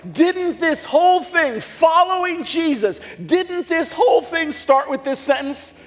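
high, loud and slow, full pulpit force; preaching shout. Real, not acted. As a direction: hammer the question home to the congregation. Preacher building his point loudly.

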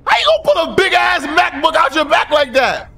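high, loud incredulous yell. Real, not acted. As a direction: yell in baffled outrage. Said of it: "I don't know why I'm yelling".

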